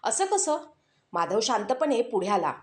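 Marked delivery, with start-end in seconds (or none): 0.0-0.6 taken aback. A narrator's reading. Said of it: "पहिल्यांदाच गंभीर झाला".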